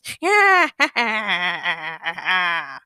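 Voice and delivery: evil villain cackle, long; maniacal cackle. Acted. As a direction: let a mad laugh ring out. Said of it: "maniacal cackle".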